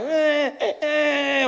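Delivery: put-on high little baby whimpers; mock baby crying. Comic mimicking other babies' crying, high whimpers. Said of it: "Other babies are crying like".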